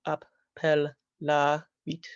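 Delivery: "appellavit" slowly broken into four syllables; syllabified. Shown as a demonstration. Teacher syllabifying a classical Latin word, slow and separated. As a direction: say "appellavit" slowly, a gap after each syllable. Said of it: "that gets syllabified into".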